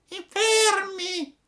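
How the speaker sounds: very high, slow mewling cat echo. A narrator's reading. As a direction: whine it like a stray cat. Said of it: "ripeté il gatto".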